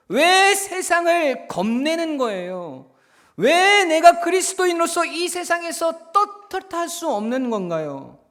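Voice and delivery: loud, high, emphatic sermon delivery; full-force preaching. Real, not acted. Preacher explaining the bully's pride to congregation, impassioned. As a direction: drive each phrase home at the top of the voice.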